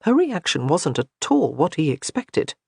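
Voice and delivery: usual speaking voice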